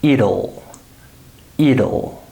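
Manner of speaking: IT'LL pronounced "iddle"; IT'LL in two syllables, "id-ul". Shown as a demonstration. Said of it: "The letter T becomes a D sound".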